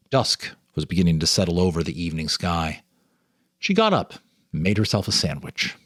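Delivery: usual speaking voice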